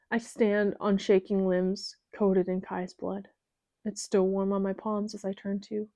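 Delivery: usual speaking voice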